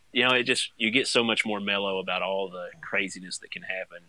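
ordinary delivery

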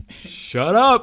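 high, loud mock dismissal; mock shut up. Reacting to silly trailer lines, loud mock shut up. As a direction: groan it out loud in disbelief. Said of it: "you're just like".

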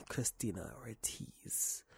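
slow soft whisper. Pretend. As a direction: breathe the name in a whisper. Whispered shout-out name.

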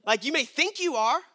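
ordinary delivery

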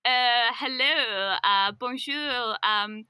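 hesitant mock French accent, drawn out; bad French accent. Salesman who can't speak French tries selling, in mock French accent. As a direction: imitate a bluffer's slow, put-on French accent. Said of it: "putting on a really French accent".

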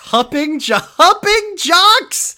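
incredulous. Real, not acted. Incredulous repeat, high.

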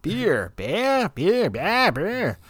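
beer said over and over, pitch swinging; repeated Boston beer. Retold or imitated. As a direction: keep repeating beer, trying the R. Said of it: "I said".

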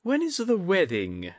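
put-on high sweet old lady's question. A narrator's reading. Atsuko asks Jiro about his wedding, sweetly high. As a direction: ask it sweetly as the old lady. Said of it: "asked in a sweet voice".

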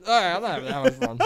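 high, loud, laughing mock protest; laughing denial. Drunk son reassuring parents, loud high laughing act. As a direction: act out the cover story while cracking up.

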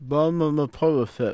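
tongue held, words slurred; holding tongue with fingers. Pretend. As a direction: say it while pinching your tongue. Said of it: "grab your tongue".

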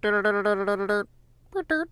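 goofy squeaky gym leader babble; high, cartoonish nonsense voice; silly high voice.

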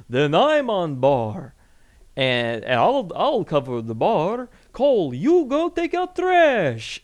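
slow, high put-on vampire voice. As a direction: drawl it out as a goofy vampire. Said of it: "I'm about to do the vampire voice".

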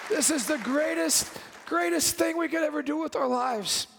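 high, tearful, choked-up voice; fighting back happy tears; crying with joy. Real, not acted. Pastor moved to tears over the young people, high and tearful. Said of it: "like I'm crying right now".

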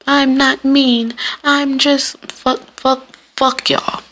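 high, slow, drawn-out self-parody; mock protest. Podcaster refusing whole episodes defending herself, mock-protesting.

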